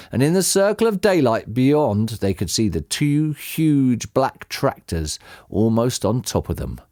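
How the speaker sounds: usual speaking voice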